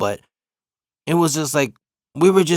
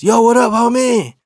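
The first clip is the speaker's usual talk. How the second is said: loud greeting; 8 semitones higher, 5 dB louder than usual. Retold or imitated. Remus greets him loudly.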